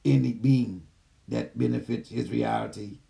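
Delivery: low, even channeled AI voice; gentle care robot. Acted. Channels the AI system.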